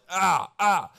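playful imitation of a child's grunts; imitated grunts. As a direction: grunt like a two-year-old stabbing the wall. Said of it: "making grunt noises".

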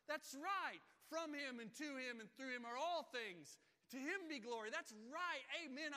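loud, high, fervent affirmation; fervent amen. Pretend. Preacher urging the church to answer loudly. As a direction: shout it back in praise. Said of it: "hears praise like this and says".

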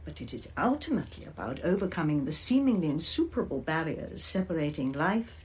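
ordinary talk

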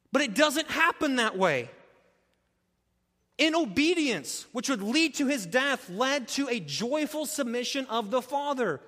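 rising fervent pulpit delivery; impassioned preaching. Acted. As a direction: preach it with full force. Preaching at full force.

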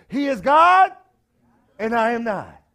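high and loud, preaching force; preacher driving home the refrain, loud. Real, not acted. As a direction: hit the refrain hard.

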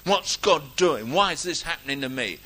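loud, slowed mock grievance; acted-out bitter questioning; bitter complaint. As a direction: gripe at God like a wronged believer. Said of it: "We get a bit bitter about it".

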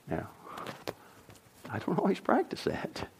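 whispered aside to the room; confiding whisper. Real, not acted. Pastor whispers an admission to his congregation. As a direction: drop to a whisper, owning up.

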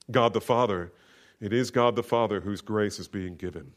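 normal talking voice

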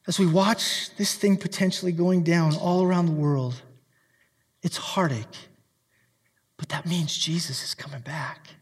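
dropped low and soft, nearly whispered; a soft, breathy confidence to the church; hushed aside. Real, not acted. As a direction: lean in and let it fall to a hush. Sermon drops to hushed aside.

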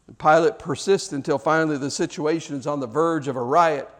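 usual speaking voice

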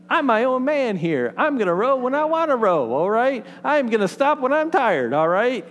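hammed-up defiant rafter refusing the guide; mock defiance. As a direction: play the proud rafter who won't take orders.

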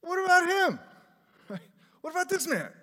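high, loud, whiny outburst; Peter's indignant question. Retold or imitated. Peter squeals in protest at Jesus about John. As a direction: squeal it like a miffed disciple. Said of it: "he said to the Lord".

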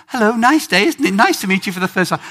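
imitated high, polite small talk hiding pain; British stiff upper lip. British cousin greeting her neighbor with fork in foot, mock-polite. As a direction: chirp pleasantries as if nothing hurts. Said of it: "because she was British, she didn't want to give away".

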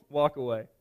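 normal talking voice

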